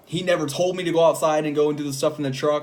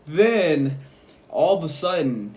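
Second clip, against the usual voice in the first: usual speaking voice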